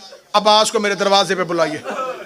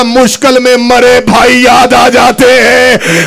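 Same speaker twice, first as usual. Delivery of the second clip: slow, loud wailing chant, half sung; anguished cry; 5 semitones higher, 10 dB louder, slower than usual. Acted. Husain crying out to Zainab, slow loud wail. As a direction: cry out the grief for a lost brother, drawn out. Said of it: "Hussain चीख मार के कहता".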